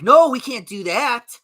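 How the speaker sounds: high, loud, slow mock refusal; mocking opponents. Streamer voicing his imagined opponents, high mock whine. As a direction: whine the refusal like a prissy critic. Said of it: "And they're like".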